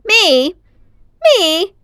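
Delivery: high, loud indignant disbelief; offended exclamation. A narrator's reading. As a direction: squawk it, outraged. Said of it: "he exclaimed".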